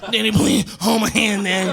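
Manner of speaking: put-on high, whimpering child plea; whiny pleading kid. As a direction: beg daddy not to leave. Son pleading on toilet.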